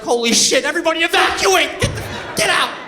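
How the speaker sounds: panicked shout. Retold or imitated. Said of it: "I'd be like".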